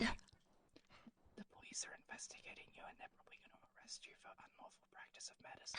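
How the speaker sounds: whispered, very soft warning; hushed warning. Pretend. Warning Nettie that police are investigating, whispered. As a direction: break it to them in a whisper.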